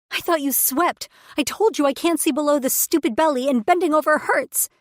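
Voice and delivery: high, loud frustrated holler. Acted. Pregnant woman hollering at her mate in the cave. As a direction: yell it out, fed up and in pain. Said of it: "I hollered at Quist".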